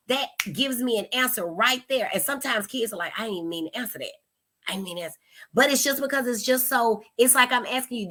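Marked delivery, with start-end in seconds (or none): 3.2-5.2 put-on mumbled, much softer kid's admission; quiet regretful admission. Kid who let an answer slip, quiet admission. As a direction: imitate a kid backpedaling under the breath.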